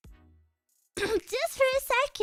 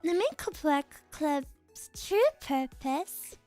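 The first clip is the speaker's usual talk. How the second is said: lower, measured and calm; composed noble girl; 7 semitones lower than usual. Acted. Same character voice continues.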